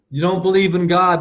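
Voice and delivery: ordinary delivery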